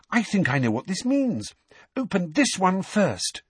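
high, breathless urgency; Hermione. A narrator's reading. Girl finding the package among the owls, breathless. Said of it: "said Hermione breathlessly".

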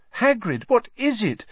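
put-on girl startled by her weeping friend, aghast. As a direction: gasp it out in alarm.

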